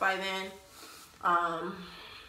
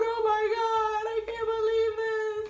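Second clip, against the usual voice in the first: loud, very high mock-hysterical mom; overdramatic wailing; 6 dB louder than usual. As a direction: squeal it tearfully, hammed-up. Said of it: "you was doing too much".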